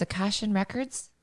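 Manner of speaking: "Akashian" with a final n, softly questioning; unsure second attempt at "Akashian"; tentative mispronunciation. Real, not acted. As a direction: try "Akashian" as ah-KAH-shun, rising at the end. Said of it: "I think I've got it right".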